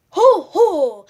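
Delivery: loud jolly ho ho; Bear shouting. A narrator's reading. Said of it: "he shouted".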